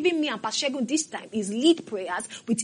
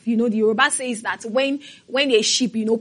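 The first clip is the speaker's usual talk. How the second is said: ordinary delivery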